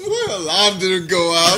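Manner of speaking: slow mock English accent. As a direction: echo the line in a put-on English accent. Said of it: "getting the English accent down".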